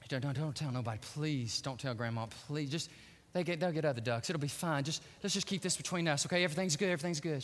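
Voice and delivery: low, soft, nervous begging; hushed pleading. Acted. Boy begs sister not to tell Grandma, hushed pleading. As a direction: plead under your breath to keep it secret.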